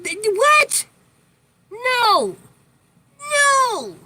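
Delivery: high dramatic disbelief; shrieked high, theatrical no; mock outrage. Outraged reaction to grandma's wish.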